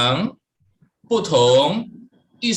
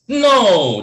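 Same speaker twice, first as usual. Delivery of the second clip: falling tone 4; 6 semitones higher, 8 dB louder than usual. Shown as a demonstration. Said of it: "jadi seperti orang jatuh".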